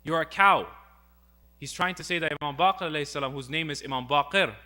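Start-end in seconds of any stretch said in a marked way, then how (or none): 0.0-0.7 louder retold insult; mock shouting. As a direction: throw the insult out loudly. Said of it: "he starts shouting towards him".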